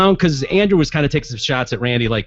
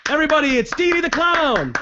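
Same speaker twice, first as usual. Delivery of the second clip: high showman announcement; announcer intro; 9 semitones higher than usual. Shown as a demonstration. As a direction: introduce her like a ringmaster. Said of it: "I don't do it like that".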